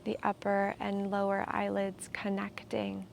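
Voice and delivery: calm, unhurried meditation guide with long pauses; soothing, measured meditation voice; guided meditation. Real, not acted. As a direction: guide them gently into stillness, leaving space between phrases.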